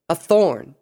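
THORN punched high and loud; indignant exclamation. A narrator's reading. Luna fuming over the escaped captive, indignant. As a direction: burst out on "thorn" in frustration. Luna fumes in a bad mood.

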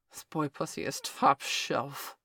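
slow grunt through clenched teeth. A narrator's reading. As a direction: grit your teeth and grunt slowly. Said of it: "Victor grunted through clenched teeth".

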